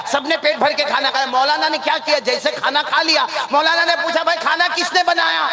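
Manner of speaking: high, carrying sermon storytelling; preacher telling a parable. Acted. Preacher tells the crowd of a maulana invited to eat, rousing storytelling. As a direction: tell it to a crowd, pitch lifting as it goes.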